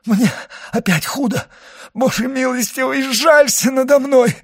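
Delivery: high, anguished plea; crying out. A narrator's reading. Sick servant begs God for pity, crying out. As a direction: beg for mercy at the top of your range. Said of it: "крикнул Барруа".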